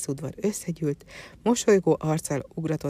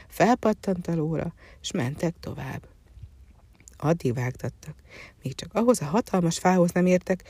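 ordinary delivery

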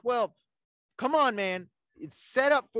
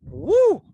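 high, loud celebratory whoop; 12 semitones higher, 10 dB louder than usual. Real, not acted. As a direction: let out a big woo. After chugging the beer.

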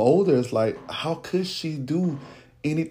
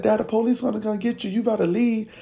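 put-on kids warning; 9 semitones higher than usual. Kids warning dad through door.

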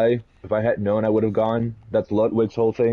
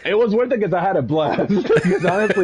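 laughing; 8 semitones higher than usual. Real, not acted. Laughing while answering.

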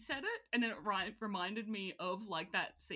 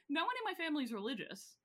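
usual speaking voice

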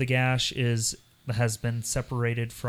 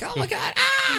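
squealing high with glee; giddy squeal. Retold or imitated. Retelling how the book thrilled him, a giddy squeal. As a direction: gush like a thrilled fan. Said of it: "I was like".

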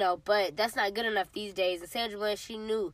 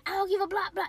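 mock cursing; 8 semitones higher, 3 dB quieter, faster than usual. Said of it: "just cursing the police".

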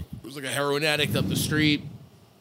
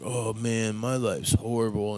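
mopey; 5 semitones lower, 5 dB quieter than usual. Retold or imitated.

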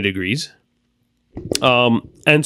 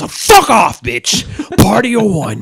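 hammed-up loud, higher brush-off; mock loud brush-off; 7 semitones higher, 6 dB louder than usual. Host imitates pool guy shouting off a girl, mock loud. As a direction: bark it off like a diva. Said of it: "he goes".

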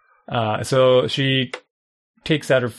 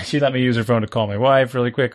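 ordinary talk

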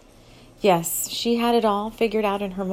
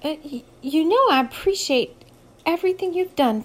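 nervous stammer, pitched higher; 8 semitones higher, 3 dB louder than usual. A narrator's reading. Moxie nervously telling her boss she needs time off, stammering. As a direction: stumble nervously into it. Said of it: "stammered her first words".

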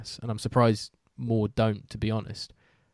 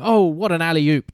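a touch louder, hyped-up play-by-play call; mock American commentator; 4 semitones higher, 5 dB louder than usual. Mimicking an American commentator to a fellow broadcaster, mildly hyped. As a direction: ham up a US basketball commentator's call. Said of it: "doesn't sound good in a British accent".

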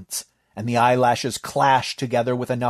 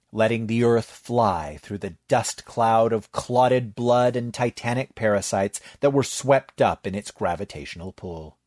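ordinary delivery

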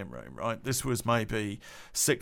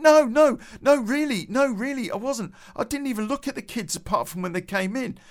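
pleading denial; 10 semitones higher, 5 dB louder than usual. Retold or imitated. Charlie protesting innocence, pitched up.

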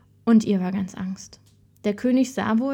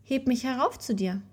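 higher-pitched, mild call; 4 semitones higher than usual. A narrator's reading. Frog asks the princess to lift him, mild high call. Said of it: "Da saß er und rief".

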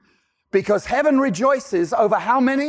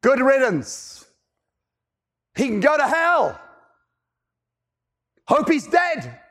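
higher, mock contempt; scornful dismissal; 4 semitones higher than usual. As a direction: spit out the brother's scorn. Voicing the scornful brother.

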